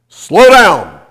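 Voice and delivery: urgent warning shout. Retold or imitated. Mysterious voice warning the speeding father, shouting. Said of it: "a voice that said".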